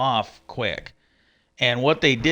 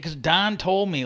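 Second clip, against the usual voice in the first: ordinary talk, nothing marked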